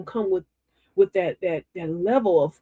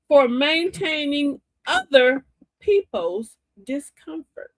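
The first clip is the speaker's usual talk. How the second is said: motivational preaching; 7 semitones higher, slower than usual. Acted. Preaching to her live viewers, slow and lifted. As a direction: stretch each phrase like a preacher.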